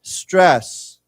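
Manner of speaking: "Stress" said loud and clear for repetition; model pronunciation. Shown as a demonstration. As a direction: lead the class on "Stress", falling at the end. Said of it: "let's say together".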